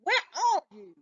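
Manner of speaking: high, calling out lost. A narrator's reading. Harold calls Chester, lost on the chair, high calling out. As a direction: call out for the missing cat. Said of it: "I cried".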